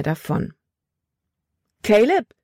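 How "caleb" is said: higher, raised call after someone leaving; calling after him. A narrator's reading. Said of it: "rief Libby ihm nach".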